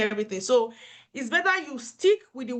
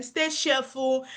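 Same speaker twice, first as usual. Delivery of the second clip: ordinary talk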